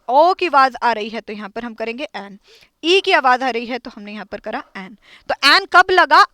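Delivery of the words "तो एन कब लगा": loud drill question. Shown as a demonstration. Teacher drilling the class on when to use an, loud questioning. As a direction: call out the question, high and loud. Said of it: "teacher drills class question".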